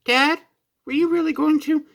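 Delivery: put-on high, small voice of a young boy; a light child Gohan voice; child's voice. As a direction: ask like a startled little kid. Child Gohan asks his father.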